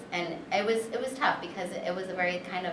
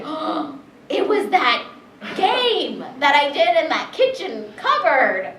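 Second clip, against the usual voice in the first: realizing; 9 semitones higher, 11 dB louder, slower than usual. Retold or imitated. Retold realization.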